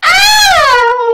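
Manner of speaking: high piercing shriek; a sudden loud, high scream; scream of shock. Acted. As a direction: shriek at the sight in the mirror. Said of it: "I nearly screamed".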